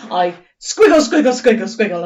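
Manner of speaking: squiggle rising and bouncing; playful squiggle. Shown as a demonstration. Teacher acting being tickled for children. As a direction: wiggle the words like being tickled. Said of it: "draw lots of wavy lines".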